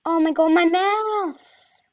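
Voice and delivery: exasperated gamer yell; frustrated outcry. Real, not acted. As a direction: cry out in real frustration at the game. Exasperated cry at faulty mouse while gaming.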